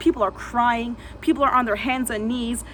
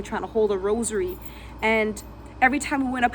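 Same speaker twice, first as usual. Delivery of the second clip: normal talking voice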